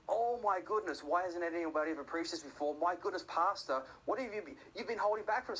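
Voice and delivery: high, gushing mock amazement; amazed congregation. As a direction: gush loudly like a bowled-over churchgoer. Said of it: "everybody responds. And it's like".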